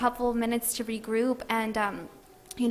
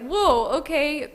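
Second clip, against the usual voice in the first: surprised; 4 semitones higher, 4 dB louder, slower than usual. Retold or imitated. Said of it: "so I was like".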